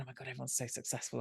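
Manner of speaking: an intimidated private thought, rushed under the breath; awed inner thought. Retold or imitated.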